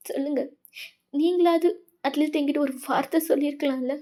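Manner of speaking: upset accusation, voice straining; hurt, tearful junior. Acted. Wayan asking her senior why she hid it, tearfully accusing. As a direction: plead and reproach through hurt.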